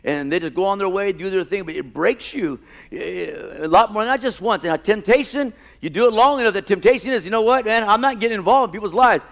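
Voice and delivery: loud, high, driving sermon delivery; full-force preaching. Acted. Pastor preaching to his church, loud and high. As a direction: preach it hard to the congregation.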